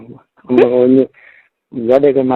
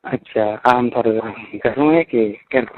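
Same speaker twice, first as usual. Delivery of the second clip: ordinary talk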